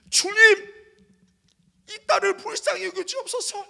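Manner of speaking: high, crying-out prayer voice; fervent prayer. Retold or imitated. Pastor praying over student, fervent high plea. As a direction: cry out to God in fervent prayer.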